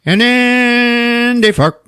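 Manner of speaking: high, long-drawn comic announcement; comic fanfare. Retold or imitated. Recapper reaching the couple's passion, mock triumphant. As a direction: stretch it out high for the big reveal. Comic climax of the retold scene.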